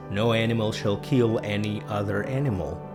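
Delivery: ordinary talk, nothing marked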